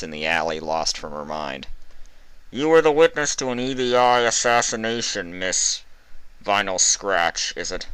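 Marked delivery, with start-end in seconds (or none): 2.5-7.9 cold interrogator. A narrator's reading. Interrogator over the speaker, cold official. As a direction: question her with detached authority.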